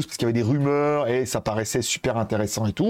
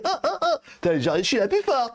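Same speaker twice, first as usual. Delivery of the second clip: high, squealing mock of a show-off girl; squeaky mock boast; mocking bragging heroine; 10 semitones higher than usual. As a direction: mimic the smug young heroine, hammed-up.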